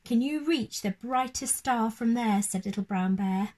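put-on gentle storybook narration for children; lively read-aloud voice for little ones; bedtime story reading. A narrator's reading. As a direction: read it like a bedtime picture book.

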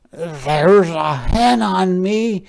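high, loud storybook pig. A narrator's reading. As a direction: complain loudly as a put-upon pig. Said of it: "said the pig".

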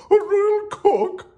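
very high, loud royal summons; hammed-up king's drawn-out call. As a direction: holler slowly for a servant. King calls for his cook.

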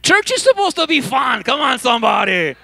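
high, loud call to the congregation; rousing shout. Real, not acted.

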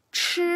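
Ch with the same curled tongue plus a puff of air; aspirated ch. Shown as a demonstration. Teacher showing the breathy Chinese ch. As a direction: push air out on Ch. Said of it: "es la misma posición, pero expulsa aire".

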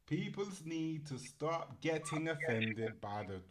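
slow, failed attempt at an Irish accent. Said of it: "I can't even say it in an Irish accent".

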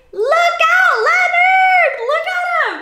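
high, loud cry of alarm; calling out a warning. Pretend. Reader calling to Leonard on the wrong tail, loud high shout. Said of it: "What do you say?".

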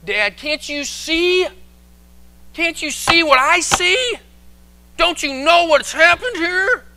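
loud, high, slow exasperated demand; angry son. Acted. Angry son demands his father see it, exasperated. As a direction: drag out each question in outraged disbelief. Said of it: "why does this angry son".

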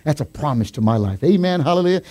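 normal talking voice

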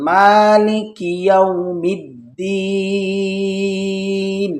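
মালিকি ইয়াউমিদ্দিন slow, কি joined into ইয়াউ; slow Quran recitation with the kaf joined. Shown as a demonstration. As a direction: recite মালিকি ইয়াউমিদ্দিন as one flowing chant. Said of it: "কাফের সাথে মিলিয়ে বলবেন".

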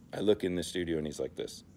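ordinary talk

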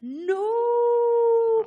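high, loud drawn-out wail; dramatic cry of dismay. Retold or imitated. Stomach ache after cheese, loud high wail. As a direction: cry out in comic despair. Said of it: "and I was like".

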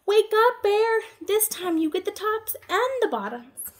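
loud, high wake-up call. Acted. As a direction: shout to rouse him. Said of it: "Hare called out".

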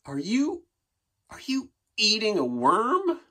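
high, rising incredulous question; shocked disbelief. Acted. Narrator catching the puppy eating a worm, high shocked rise. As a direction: sound shocked at what you see.

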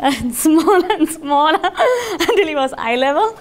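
words broken by real laughter; can't stop laughing mid-sentence; laughing while talking. Real, not acted. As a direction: giggle through the words. Laughs while telling robot story.

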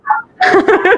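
sudden delighted laugh; amazed laugh. Real, not acted. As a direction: burst of high laughter. Amazed at the impression.

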